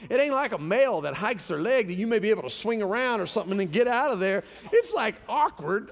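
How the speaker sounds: mock-indignant questioning of the wife; retold disbelief.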